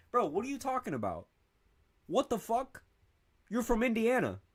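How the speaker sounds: very high incredulous protest; mock outrage. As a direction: squeal it in disbelief at them. Mock confronting people with accents.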